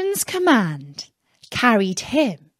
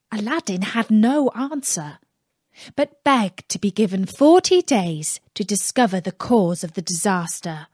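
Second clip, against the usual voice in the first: usual speaking voice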